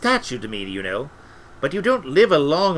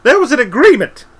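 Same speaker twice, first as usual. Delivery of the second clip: very high, very loud yell; shrieked, frantic insistence; desperate shouted protest; 13 semitones higher, 13 dB louder, faster than usual. A narrator's reading. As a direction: shout it at the top of the voice. Albert repeats his protest, escalating.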